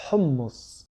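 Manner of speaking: "Hummus" with ḥ breathed from the throat; throaty Arabic ḥ in hummus. Shown as a demonstration. Teacher repeats "Hummus" with the throaty ḥ. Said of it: "du fond de la gorge".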